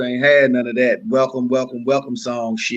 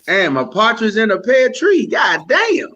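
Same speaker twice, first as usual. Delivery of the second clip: mock amazed shout; 8 semitones higher than usual. Real, not acted. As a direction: shout it out like the list never ends. Guest mocks the long school list.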